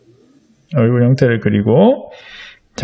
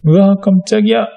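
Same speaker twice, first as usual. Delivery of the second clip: higher-pitched cartoon startle; 7 semitones higher than usual. Shown as a demonstration. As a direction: act out a bigger, comic-style jolt of surprise. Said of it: "더 놀랐을 때". (만화처럼 높게 깜짝 놀란 척)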